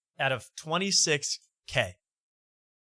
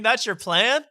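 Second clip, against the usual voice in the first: high, rising disbelief; incredulous question; 7 semitones higher, 4 dB louder than usual. Real, not acted. Host challenging the comedian's fundraising scheme, incredulous.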